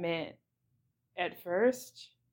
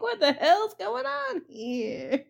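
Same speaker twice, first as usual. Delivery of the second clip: high, slow, put-on voice of a confused delivery guy; baffled pizza driver; 11 semitones higher, slower than usual. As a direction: squeak it out like a startled pizza driver, slowly. Pizza driver confronts racers.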